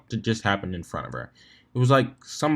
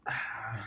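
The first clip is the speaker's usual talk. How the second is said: tired whispered sigh; 14 dB quieter than usual. Real, not acted.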